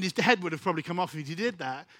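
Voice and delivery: usual speaking voice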